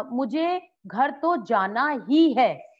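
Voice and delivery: slow, emphatic, weight on ही; ही stressed, slow delivery. Shown as a demonstration. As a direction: drag it out, hit ही hard. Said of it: "ही को रेखांकित करती हूँ".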